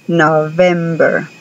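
slow model of the English V. Shown as a demonstration. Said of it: "pegar los dientes de arriba al labio de abajo y decir V".

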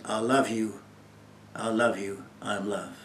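soft, low and tender; quiet loving assurance from God; tender divine voice. Retold or imitated. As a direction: whisper it like a gentle promise. God's message to the listener.

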